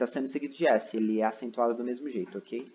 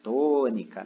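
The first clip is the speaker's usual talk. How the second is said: TÔNICA with TÔ drawn out and louder; calling the word; 5 dB louder, much slower than usual. Shown as a demonstration. Teacher calls the word to find its stressed syllable, TÔ in "Tônica" stretched. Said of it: "Chamar a palavra".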